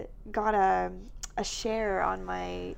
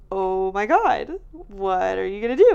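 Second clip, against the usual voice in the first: loud, stunned "what are you gonna do"; excited disbelief; 10 dB louder than usual. Retold or imitated. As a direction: burst out in shocked excitement. Excited retelling of shock.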